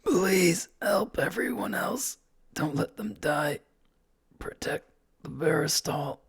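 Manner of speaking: slow, high, whispery dying wheeze; wheezing. A narrator's reading. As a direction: wheeze out a last plea, softly and slowly. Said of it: "He's able to wheeze out".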